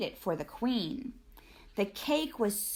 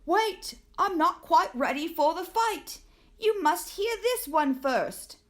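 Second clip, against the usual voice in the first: higher, stalling knight voice; nervous knight; 6 semitones higher than usual. Acted. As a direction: knight begging the dragon to wait, higher and pleading. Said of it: "who told the dragon".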